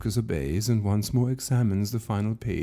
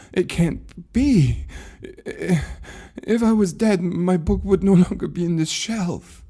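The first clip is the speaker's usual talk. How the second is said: higher, louder, rising desperate protest; refusing to believe he is dead; panicked denial; 9 semitones higher, 4 dB louder than usual. Acted. Lucas pleads with the keeper that he cannot be dead, panicked. Lucas denies his own death.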